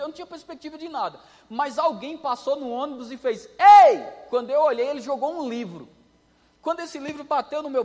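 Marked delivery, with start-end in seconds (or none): 3.6-4.0 loud, high shout across the road; calling out. Retold or imitated. Passenger on the bus hails the cane cutter, loud shout. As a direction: yell to grab his attention.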